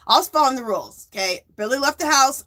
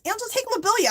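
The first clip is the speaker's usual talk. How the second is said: friend's advice; 13 semitones higher, faster than usual. Retold or imitated. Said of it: "She goes".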